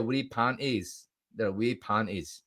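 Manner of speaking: slow, drawn-out Scottish lilt; Scottish accent. Pretend. Streamer reacting to a rival's post, playful Scottish accent. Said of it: "I slipped into a Scottish there".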